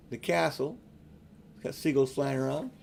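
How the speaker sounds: ordinary delivery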